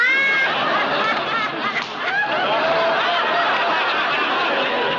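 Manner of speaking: big, high burst of laughter; loud laugh. Real, not acted.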